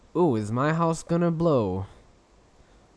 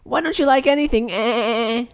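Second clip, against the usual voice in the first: pitched up, bright host voice; higher voice; 3 semitones higher than usual. Acted. Host restarts the show in a higher voice for his co-host. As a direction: lift the voice up for the show. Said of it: "I'm gonna talk in a, in a higher voice".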